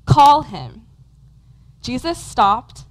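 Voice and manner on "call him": louder, higher acted command; firm raised call. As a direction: give the order so it carries. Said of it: "and said".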